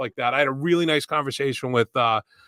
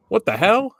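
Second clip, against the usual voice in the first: higher, louder imitation of a startled stranger; mock surprise; 8 semitones higher, 5 dB louder than usual. A guy at the gas station spots the hat, mildly mock-startled.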